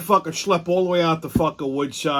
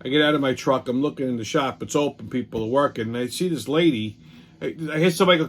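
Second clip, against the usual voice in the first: ordinary talk, nothing marked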